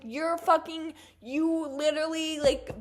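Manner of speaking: yelling threats at the rude psychic; furious shout. Retold or imitated. Retelling her outburst at the tarot reader, loud angry yelling. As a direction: scream it, cursing him out. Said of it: "I was like".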